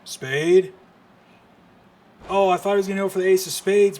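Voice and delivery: sudden loud burst, fast and high; real surprise in the call; excited call. Real, not acted. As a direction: explode as the move lands. Live call of a big spot.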